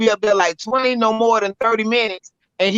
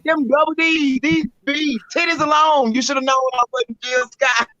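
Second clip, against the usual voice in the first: sassy boast; 5 semitones higher than usual. Retold or imitated. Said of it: "I said".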